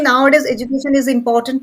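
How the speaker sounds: quick run-through without stress; how people speak. Shown as a demonstration. Teacher imitating how people speak, fast and unstressed. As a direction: rattle it off fast, no word standing out. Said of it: "people speak like that".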